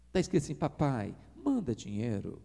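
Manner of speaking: wife reading the message sweetly; soft pleading read. Retold or imitated. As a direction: read it as a gentle request. Wife reads message softly pleading.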